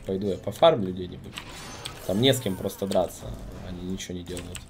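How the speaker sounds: ordinary delivery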